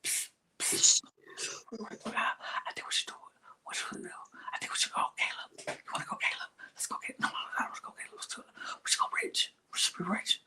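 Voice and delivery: hushed mock whisper of plotters; conspiratorial stage whisper. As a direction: whisper it like a secret tribe plot. Mimicking the tribe whispering.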